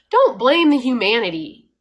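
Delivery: normal talking voice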